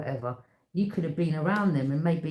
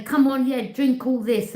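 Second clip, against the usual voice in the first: mock sleazy coaxing; 7 semitones higher, 6 dB louder than usual. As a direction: egg them on to drink. Voices a predator luring youths.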